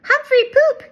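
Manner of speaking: high gleeful shout; giggly toddler yell. Acted. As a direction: yell it out like a giggling little boy. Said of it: "he yelled".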